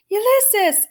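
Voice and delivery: high calling out; shouted name. A narrator's reading. Flora calling for Ulysses in the dark, shouting. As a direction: call out across the night. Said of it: "shouted Flora".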